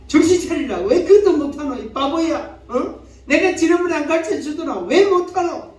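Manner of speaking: high-pitched berating; scolding. Acted. Preacher acts out the other party berating him, scolding. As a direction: tell them off, pitched well up. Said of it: "이런 소리를 자꾸 듣게 돼요".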